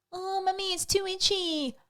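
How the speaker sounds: put-on high, soft, drawn-out little-girl whine; mock plaintive kiddie voice, stretched at the end; whining child. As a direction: imitate a small child complaining to mum. Imitating daughter's complaint about the dress.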